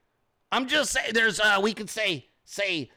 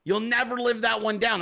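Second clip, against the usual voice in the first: ordinary talk, nothing marked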